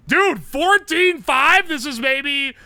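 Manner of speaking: high, loud, stretched-out disbelief; can't believe the score, drawn out slow; slow excited shout of surprise; amazed. Real, not acted.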